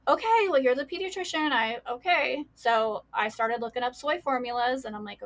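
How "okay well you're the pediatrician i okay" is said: high, loud mock exasperation at the doctor; exasperated okay. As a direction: throw up your hands, retelling giving in. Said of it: "So I'm like".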